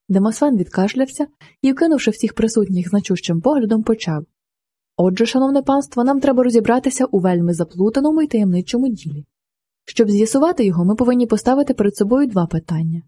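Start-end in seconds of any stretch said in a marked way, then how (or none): none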